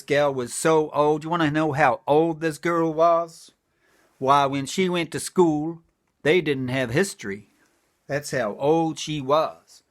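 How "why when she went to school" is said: ordinary delivery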